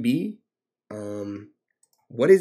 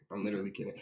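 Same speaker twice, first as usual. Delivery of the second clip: ordinary talk, nothing marked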